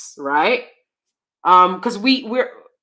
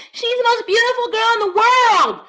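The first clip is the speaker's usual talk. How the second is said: squealing high gush over a crush; breathless fangirl rave; 13 semitones higher than usual. Retold or imitated. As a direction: gush it like a starstruck teen. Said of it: "I was like".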